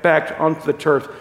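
ordinary talk

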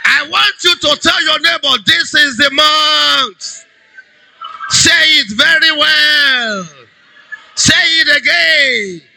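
high, sustained preacher's call; rally the congregation, stretching the phrase endings; rousing exhortation. Real, not acted.

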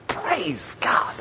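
hushed, voiceless whisper; whispered praise. Real, not acted. Awed whispered aside after the reading.

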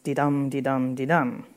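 de-dum with the weight on dum each time; iambic rhythm. Shown as a demonstration. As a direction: tap out de-dum, light then heavy. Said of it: "first unstressed, second stressed".

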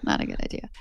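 a soft, breathless "Not a good idea"; whispered aside. Real, not acted. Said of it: "whispered repeat of the remark".

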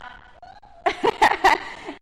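loud, high bright laugh; burst of laughter. Real, not acted. Laughing after the shout-out to Taylor, loud and high.

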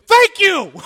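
high, loud, mock-grateful thank you; sarcastic shout. Pastor mocking the lady who scolded him, high and loud. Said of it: "And I'm like".

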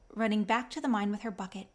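ordinary delivery, nothing marked

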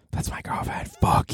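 unvoiced whispered aside; hushed conspiratorial whisper. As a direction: whisper it like a secret to listeners. Repeats claim as whispered aside.

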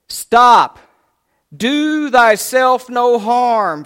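loud, high, drawn-out shout; crying out. Acted. Paul calling to the jailer about to fall on his sword, crying out. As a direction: bellow an urgent command, stretching each word. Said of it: "it says that he cries out".

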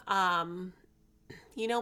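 usual speaking voice

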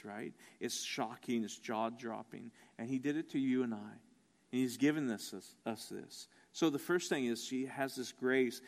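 low, soft reflective delivery with long pauses; hushed preaching. Real, not acted. Preacher marvels at Rahab in Jesus' lineage, hushed. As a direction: let each revelation land quietly.

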